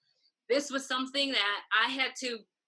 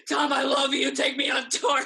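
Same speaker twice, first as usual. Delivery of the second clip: higher, louder mock plea through laughter; hammed-up gushing shout; 4 semitones higher, 7 dB louder than usual. Mock begging Tom to take her on tour, gushing shout. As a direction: beg like an overeager fan. Hamming up her own slip.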